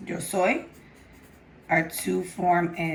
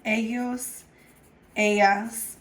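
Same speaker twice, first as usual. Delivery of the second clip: usual speaking voice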